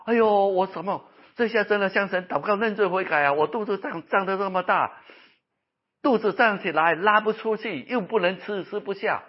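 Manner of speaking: high, emphatic, distressed retelling; anguished complaint. Retold or imitated. Preacher voices patient with swollen belly, anguished complaint. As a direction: imitate a patient moaning in alarm.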